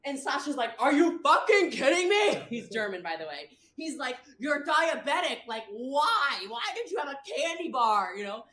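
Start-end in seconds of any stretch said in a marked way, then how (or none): 0.8-2.4 high, loud incredulous yell; imitated outraged shout. Sasha's outburst, retold. 4.4-8.2 raised, higher-pitched incredulous scolding; imitated exasperated scolding. Sasha scolding the diabetic, retold.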